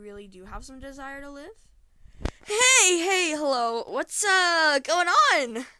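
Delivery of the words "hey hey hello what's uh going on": loud, high, panicked blurt; panicked greeting. Acted. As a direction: blurt out a frantic, overloud hello to a monster. Blurting to monster in blind panic.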